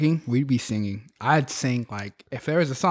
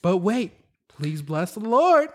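high, drawn-out overly sweet greeting; impression of Sarah; 9 semitones higher than usual. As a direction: gush like a cheesy barista. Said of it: "it's time to put in my Sarah".

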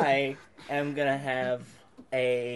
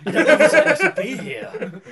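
movie quote voice; 11 semitones higher, 8 dB louder than usual. Retold or imitated. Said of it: "doing quotes from the film".

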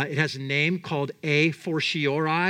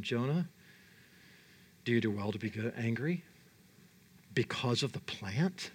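low and soft, a patient question; God gently questioning an angry Jonah; gentle God; 8 semitones lower, 9 dB quieter than usual. Pretend. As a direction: ask it quietly, like a patient father. God's gentle question voiced.

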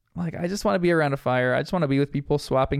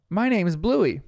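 pitched-up retold shout; mock yell; 5 semitones higher than usual. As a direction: lightly imitate a child calling back.

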